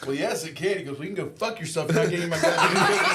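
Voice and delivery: loud, laughing granddad snap; loud punchline. Retold or imitated. Granddad telling grandson off over cookies, loud and laughing. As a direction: belt the punchline through a laugh.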